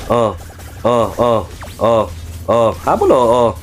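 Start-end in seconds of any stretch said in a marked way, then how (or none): none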